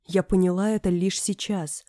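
loud, excited outburst; exclaiming. Acted. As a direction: burst out with the sudden realisation. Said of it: "воскликнула я".

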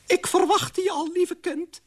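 very high-pitched character voice; old aunt figure. Acted. Aunt Oena welcomes the queen, high character voice. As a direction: pipe it up in a high put-on voice.

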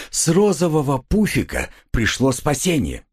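ordinary delivery

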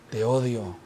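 grave, bajo y contenido; odio contenido fingido. Acted. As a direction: finge un odio callado. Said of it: "Así como diciendo". (odio en voz baja)

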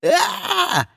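high-pitched yelp; cry of pain. A narrator's reading. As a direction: yelp from a sudden blow. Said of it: "вскрикнул Ларс". (вскрик от боли высоким голосом)

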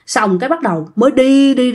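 plain reading voice

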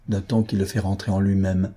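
ordinary delivery, nothing marked

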